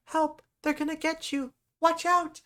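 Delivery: high-pitched little creature's cry; child calling for help. Acted. Child of the forest warns Simon, high urgent cries.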